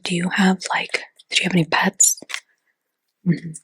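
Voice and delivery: ordinary talk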